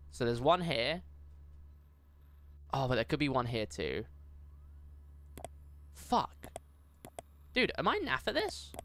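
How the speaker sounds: ordinary talk, nothing marked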